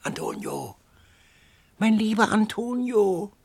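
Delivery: put-on a hoarse old voice crying out pitifully, high and loud; hoarse plaintive call. A narrator's reading. An old woman calling to the wounded youth, hoarse and plaintive. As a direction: wail the name plaintively. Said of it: "rief eine heisere Stimme recht kläglich".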